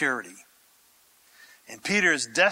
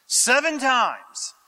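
usual speaking voice

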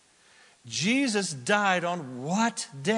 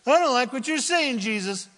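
high, loud, quick protest; a lightly voiced offended Pharisee; indignant Pharisee; 6 semitones higher, 4 dB louder than usual. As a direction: push back hard at Jesus. Pharisee objects to Jesus.

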